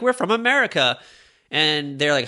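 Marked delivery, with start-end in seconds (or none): 0.0-1.0 mock proud teen. Teens voiced in retold story.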